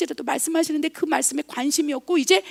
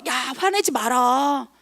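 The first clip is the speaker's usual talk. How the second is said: voice of conscience; 2 semitones higher, 4 dB louder than usual. Retold or imitated. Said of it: "그게 양심의 소리인 거예요".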